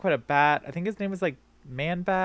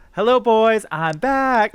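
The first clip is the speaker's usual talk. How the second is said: higher, louder triumphant hero; movie hero quote; 7 semitones higher, 7 dB louder, slower than usual. Retold or imitated. [name] quoting Independence Day, triumphant hero. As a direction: announce your return like the movie hero. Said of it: "in Independence Day when it was like".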